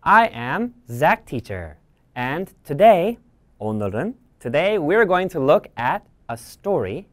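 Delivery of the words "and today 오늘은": plain reading voice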